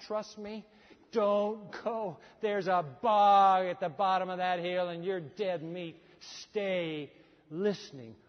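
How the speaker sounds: normal talking voice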